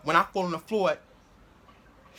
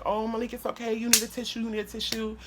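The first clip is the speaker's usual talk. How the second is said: higher, soft cooing voice; soft, raised mock-soothing tone; imitated consoling teacher; 4 semitones higher, 4 dB quieter than usual. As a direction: fuss gently over a crying pupil, in imitation. Teacher consoling crying boy, retold.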